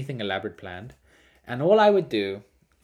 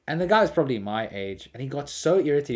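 usual speaking voice

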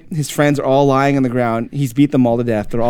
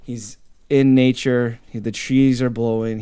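plain reading voice

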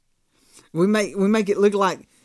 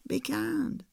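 much softer, almost murmured; soft echo; 8 semitones higher, 9 dB quieter than usual. Real, not acted. Pastor repeating his point to listeners, softly. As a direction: let it land gently. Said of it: "soft echo after climax".

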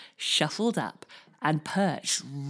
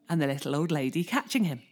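plain reading voice

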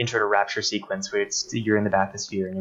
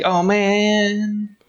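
high, loud dismayed cry; Doctor Tenenbaum; 11 semitones higher, 4 dB louder than usual. Retold or imitated. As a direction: yelp it out in sudden dismay. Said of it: "Doctor Tenenbaum's like".

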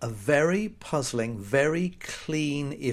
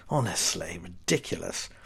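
low, soft grumble; muted exasperation; 8 semitones lower, 6 dB quieter than usual. Acted. Customer dismissing a silly warning, muted exasperation. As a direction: drop low and grumble in disgust.

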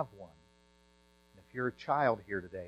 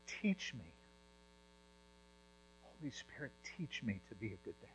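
much softer, whispered plea; hushed prayer; 16 dB quieter than usual. Retold or imitated. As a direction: pray it barely above a breath. Retelling father's prayer.

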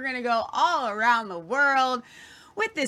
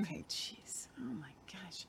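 real whisper, much softer; shocked whisper; 3 semitones lower, 18 dB quieter than usual. Real, not acted. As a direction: breathe it out, taken aback. Recoils at grim killing remark.

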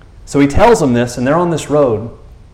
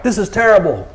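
high-pitched, exasperated dressing-down; hammed-up dismay at the disciples; mock scolding; 7 semitones higher than usual. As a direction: act out a scandalized telling-off. Acting out scolding Jesus didn't give.

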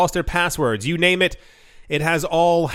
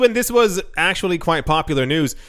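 ordinary delivery

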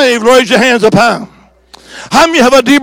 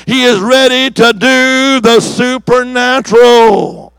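stretched out, every word weighted; slow it down to land it; slow emphatic; slower than usual. Real, not acted. Preacher repeating the promise to the church, slow.

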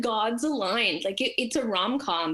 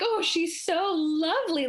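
high, slow gushing admiration; acted-out doting praise; adoring coo; 7 semitones higher, slower than usual. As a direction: draw it out like melting over a dog. Acts out adoring the dog.

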